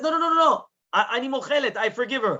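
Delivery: high, insistent protest in retelling; hurriedly insist, pitched well up; urgent retraction. Retold or imitated. Wife hastily retracts her grudge.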